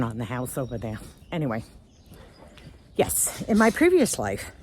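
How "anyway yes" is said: usual speaking voice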